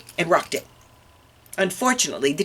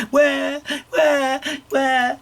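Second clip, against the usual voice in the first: put-on high wailing baby "wah"; baby crying; 11 semitones higher, much slower than usual. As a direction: imitate a baby starting to cry. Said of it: "started to cry".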